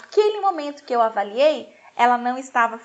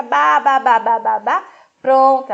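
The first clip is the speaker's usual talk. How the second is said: mother imitating baby; 7 dB louder, slower than usual. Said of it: "se a mãe fala assim".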